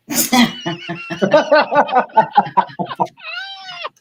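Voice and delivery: big shrieking laugh, high and loud. Real, not acted. Laughing hard at the grandson's punchline, high and loud. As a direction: crack up at your own story.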